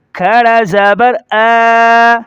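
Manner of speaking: slow spelling chant, "آ" held long and level; کھینچ کر ادائیگی. Shown as a demonstration. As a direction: stretch the final "آ" for one alif. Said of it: "ایک الف کے بقدر کھینچا جائے گا". ("آ" کو ایک الف کے برابر کھینچ کر)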